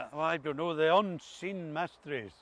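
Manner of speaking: ordinary talk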